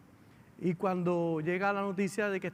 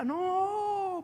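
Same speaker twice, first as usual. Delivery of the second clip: anguished cry; 13 semitones higher than usual. Retold or imitated. A sister hearing of the pregnancy cries out in anguish. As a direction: let the no break out of you in shock. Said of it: "pero con un grito de adentro".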